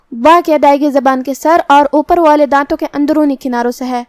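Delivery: ordinary delivery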